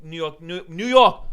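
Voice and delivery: normal talking voice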